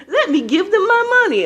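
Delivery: very high, falsetto mocking voice; mocking a gullible giver. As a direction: ridicule the people who give freely. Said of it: "you's like".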